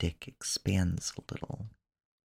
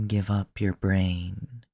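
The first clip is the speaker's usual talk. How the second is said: ordinary talk, nothing marked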